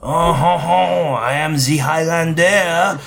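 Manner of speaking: hammed-up French accent, high and loud; slow, booming mock-French introduction. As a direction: draw it out in an exaggerated French accent. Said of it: "And he goes like".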